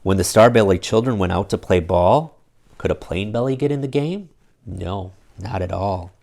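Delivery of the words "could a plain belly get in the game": ordinary delivery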